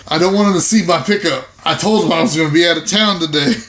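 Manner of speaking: ordinary talk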